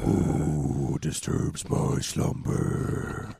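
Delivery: low, slow, breathy stone giant; grinding stone statue. Pretend. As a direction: drag it out like an awakened cave of stone. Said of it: "if [name] did the soundtrack for Aladdin".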